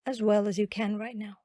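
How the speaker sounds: ordinary talk, nothing marked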